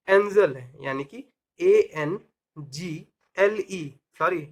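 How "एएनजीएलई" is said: slow letter-by-letter spelling; spelled out slowly. Shown as a demonstration. Teacher spelling a word for learners, slowly letter by letter. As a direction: space the letters well apart.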